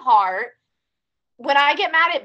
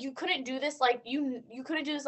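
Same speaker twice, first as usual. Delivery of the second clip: muttered to dog; 9 dB quieter than usual. Retold or imitated. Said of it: "I'm always like".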